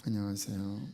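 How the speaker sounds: low, soft, flat-hearted hello; cold greeting. Retold or imitated. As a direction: greet as if you barely care. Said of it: "인사할 때도".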